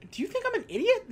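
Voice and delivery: high, scornful mock question; mock scornful burglar. Burglar scoffing at the kid, mock scorn. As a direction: sneer it like the burglar. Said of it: "And he goes".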